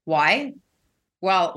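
ordinary delivery

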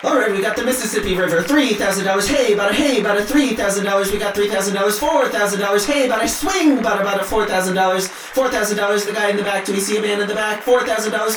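fast, high-pitched auctioneer patter. Retold or imitated. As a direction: rattle off bids like a livestock auctioneer. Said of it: "as in".

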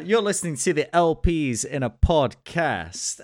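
host-style intro, second go; show intro. Acted. Redoing the podcast intro.

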